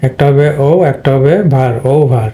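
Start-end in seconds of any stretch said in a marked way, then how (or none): 1.9-2.3 ও ভার as "ou-bhar", gliding first vowel; two syllables. Shown as a demonstration. Teacher divides the word for students, two syllables. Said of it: "divide করলে হবে দুইটা".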